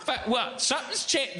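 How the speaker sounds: high mock child voice; put-on kid voice, faltering comeback. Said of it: "this is where the kid always goes".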